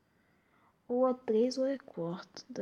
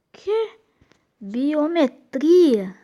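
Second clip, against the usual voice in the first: surprised; 5 semitones higher, 11 dB louder, much slower than usual. Real, not acted. Shocked at quiz answer.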